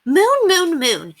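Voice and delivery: high, loud burst of discovery; light up as it clicks; excited realization. A narrator's reading. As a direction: eureka moon. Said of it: "Penelope realized with growing excitement".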